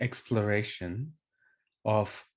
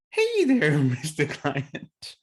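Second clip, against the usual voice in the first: playful coach voice; 3 semitones higher, 3 dB louder, slower than usual. Retold or imitated. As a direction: greet the client with a laughing, playful lilt. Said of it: "And that approach is".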